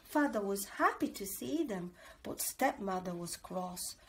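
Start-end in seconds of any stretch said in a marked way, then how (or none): none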